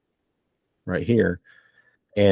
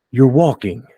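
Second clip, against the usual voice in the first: shocked; 5 semitones higher, 6 dB louder than usual. Acted. Father shocked to see daughter walk.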